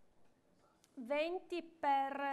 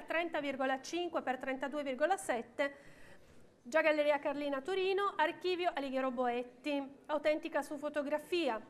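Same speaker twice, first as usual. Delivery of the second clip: ordinary delivery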